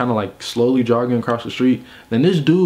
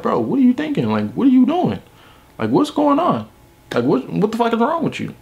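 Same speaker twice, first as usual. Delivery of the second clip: high-pitched, incredulous pleading; retold dressing-down, pitched way up; exasperated scolding; 10 semitones higher than usual. Walking his roommate across the street, exasperated.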